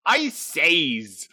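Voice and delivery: usual speaking voice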